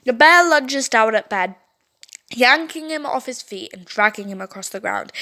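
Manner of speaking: ordinary delivery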